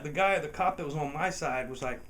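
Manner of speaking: ordinary talk, nothing marked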